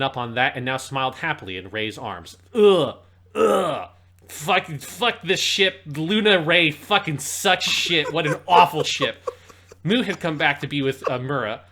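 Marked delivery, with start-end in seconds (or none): 2.5-3.9 loud disgusted ugh; disgusted groan. Real, not acted. Disgust at unwanted ship. 4.3-9.3 angry rant. Real, not acted. Ranting about hated ship.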